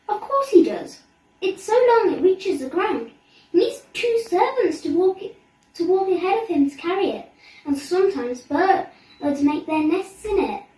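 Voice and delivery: bragging princess; proud boasting. A narrator's reading. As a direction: show off about the king's beard. Mirabelle boasting.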